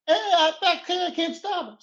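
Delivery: high panicked voice; panicked yell. Acted. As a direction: panic as the brakes fail. Driver panics, brakes fail.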